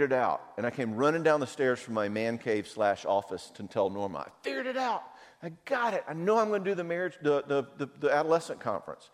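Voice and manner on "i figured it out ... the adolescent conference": high, breathless rush of excitement; excited. Retold or imitated. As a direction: burst in bursting with the news, much higher. Excitedly telling wife he figured it out.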